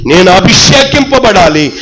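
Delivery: rising, rhythmic sermon chant; fervent preaching. Acted. Pastor urging the church, fervent preaching. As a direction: preach each line to the congregation.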